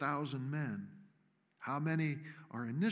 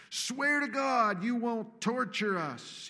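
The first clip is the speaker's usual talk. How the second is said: high, slow demonic shriek; 8 semitones higher, 6 dB louder than usual. A narrator's reading. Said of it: "he shrieks".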